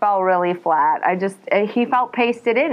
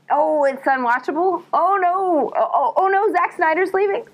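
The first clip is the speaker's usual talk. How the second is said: high, wailing "oh no" panic; mock dismay; 10 semitones higher than usual. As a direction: whine it in rising horror. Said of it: "I was like".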